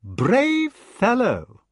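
very slow, high, mocking drawl; icy. A narrator's reading. Mordred mocks the brother barring him, icy. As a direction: draw it out with cold scorn. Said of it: "remarked the icy voice".

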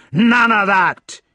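loud, high, drawn-out Scots shout; angry shout. A narrator's reading. Towering brother swings on Mordred, shouting. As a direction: roar it out in a Scottish accent. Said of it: "he shouted".

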